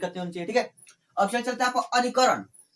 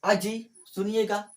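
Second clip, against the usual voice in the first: calling out; slower than usual. Shown as a demonstration. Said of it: "नहीं बोलते इस तरह".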